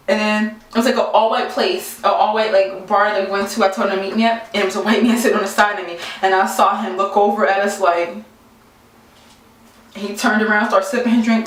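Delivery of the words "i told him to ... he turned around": normal talking voice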